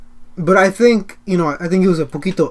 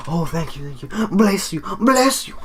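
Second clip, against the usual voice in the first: gushing mock gratitude; 2 semitones higher, 4 dB quieter than usual. Customers thanking the cashier for food, gushing mock gratitude. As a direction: pile up the blessings, pitch rising each time.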